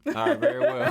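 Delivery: high laughing mock-proper reply. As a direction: giggle it out, playing posh.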